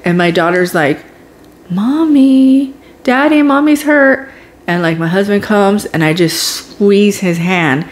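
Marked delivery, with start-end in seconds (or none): 1.7-4.3 daughter calling out. Retold or imitated.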